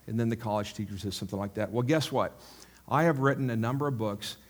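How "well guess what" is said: usual speaking voice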